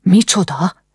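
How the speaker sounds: shocked, disbelieving question; a touch of appalled surprise; horrified. A narrator's reading. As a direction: react with sudden horror. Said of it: "Ág elszörnyedt".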